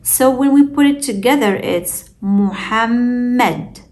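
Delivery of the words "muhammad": Muhammad slowly, as mu-hamm-med with a long m; slow Muhammad with a lengthened, hummed mm; held double m. Shown as a demonstration. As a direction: hold the middle m of Muhammad, then release into med. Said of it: "don't forget to hold the meme".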